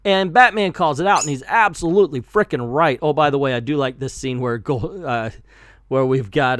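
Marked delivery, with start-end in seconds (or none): none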